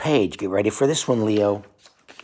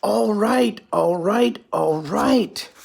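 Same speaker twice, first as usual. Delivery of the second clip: much higher, fussy puppet monster voice; Grover voice; 10 semitones higher, much slower than usual. Acted. Parent voicing Grover giving in, high puppet voice. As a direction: pitch it up high like a flustered muppet. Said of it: "Grover says".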